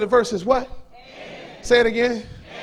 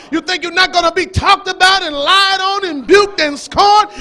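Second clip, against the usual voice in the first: full-force preaching; 4 semitones higher than usual. Real, not acted. Preacher challenging believers to bear the cross, shouted. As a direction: hammer each question at them.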